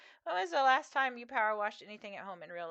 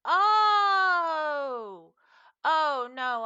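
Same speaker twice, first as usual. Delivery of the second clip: mock alarmed cry; 8 semitones higher, 12 dB louder, much slower than usual. Retold reply, loud cry.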